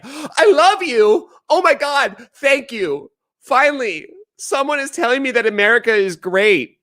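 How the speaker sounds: high-pitched swooning thanks; mock gushing gratitude. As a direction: gush with exaggerated relief, high-pitched. Acts out absurdly grateful reaction.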